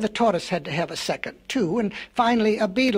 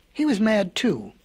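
plain reading voice

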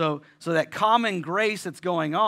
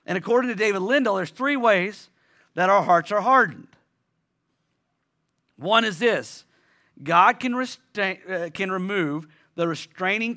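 raised, insistent pulpit voice; preaching; 5 semitones higher, 5 dB louder than usual. Acted. Pastor preaching to the church, raised and insistent. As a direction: drive each line home to the congregation. Opening point preached strongly.

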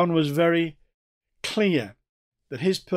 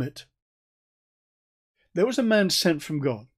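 scripture reading. Acted. Preacher reads John's prologue aloud.

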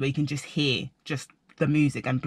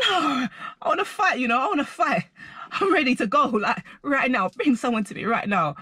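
mock fight-ready rant; 8 semitones higher than usual. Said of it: "but I was so angry".

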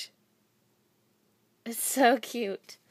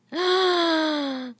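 short, high gasp of delight; 7 semitones higher, 5 dB louder than usual. Real, not acted. Holding up the bee charm, a delighted gasp. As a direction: gasp again, just as thrilled.

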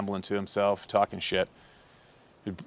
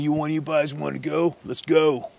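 raised-pitch bully spoiling for a fight; belligerent challenge; 6 semitones higher than usual. Acted. Drunk hunter squaring up to the guides, belligerent challenge. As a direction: call them out, daring anyone to swing. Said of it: "Like this, right?".

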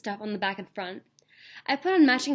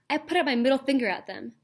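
ordinary delivery

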